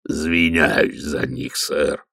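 low, slow troll rumble; lightly voiced lumbering troll. Troll Detritus speaks.

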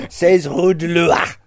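loud, slow, overdone French; mock French accent. Teasing co-host over the French address, mock French accent. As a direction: parody the co-host's French. Mocks co-host's French pronunciation.

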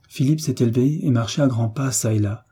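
usual speaking voice

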